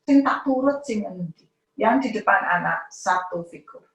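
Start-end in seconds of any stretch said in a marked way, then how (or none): 0.1-1.5 put-on confused child.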